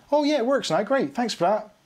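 high, chirpy relieved customer; cheery caller voice. Acted. Clueless caller finally fixes printer.

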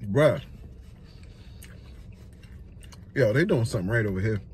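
talking between bites; chewing while talking. Real, not acted. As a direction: mouth partly full. Seen 3: Bit food at -7; jaw working, food in hand throughout.

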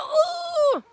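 high, loud ooh; a sudden delighted yelp; surprised squeal. Real, not acted. Startled while snuggling.

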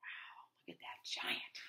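hushed awed whisper. Real, not acted. Reader whispers about the giant, in awe.

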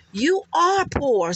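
slow "You are poor" with "are" hit high and hard; emphatic ARE. Acted. Slow emphatic "are" telling Nesto he is broke. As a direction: lean hard on "are", slowly.

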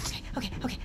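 whispered, nervous okays; breathless whisper. Real, not acted. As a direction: whisper to calm yourself down. Calming self during game.

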